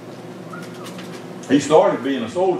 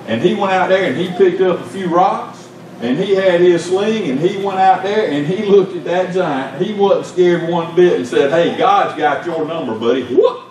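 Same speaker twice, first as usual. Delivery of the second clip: high-pitched storytelling up to a whoop; impassioned preaching; 6 semitones higher than usual. Real, not acted. Preacher tells how David faced the giant, impassioned. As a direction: drive the tale up to its climax.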